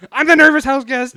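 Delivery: high, very loud yelp; imitated kid's yell. Grabbed kid yells and runs away, high shout. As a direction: yell it in a burst like a fleeing kid. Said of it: "he'd just yell, like".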